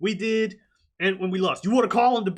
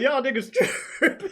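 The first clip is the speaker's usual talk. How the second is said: high mocking; 7 semitones higher than usual.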